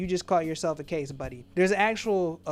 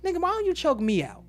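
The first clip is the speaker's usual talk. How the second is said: loud, high-pitched acted-out taunting; mock taunting; 5 semitones higher, 5 dB louder than usual. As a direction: act out a loud, jeering challenge. Mock confrontational quote, acted out.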